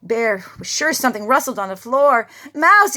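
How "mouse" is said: much higher, much louder cry; frightened cry. A narrator's reading. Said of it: "he cried".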